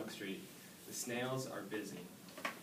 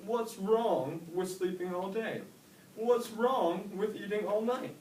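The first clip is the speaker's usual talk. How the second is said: high, loud defensive dad; slow, insisting father; dad defending lazy snail ways; 10 semitones higher, 5 dB louder than usual. A narrator's reading. Dad voiced.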